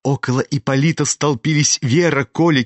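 plain reading voice

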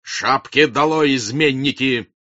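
full-voiced commanding shout; full-blooded shout. A narrator's reading. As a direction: bellow the order at the crowd. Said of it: "крикнул полнокровный голос Ростова".

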